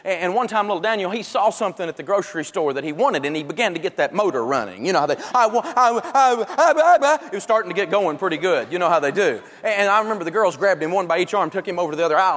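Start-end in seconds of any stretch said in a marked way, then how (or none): 5.3-7.3 put-on rising whiny toddler wail building up; toddler winding up. Dad mimicking his son's grocery store meltdown, building whine. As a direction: imitate a kid starting a tantrum, getting higher. Child's tantrum motor starting up.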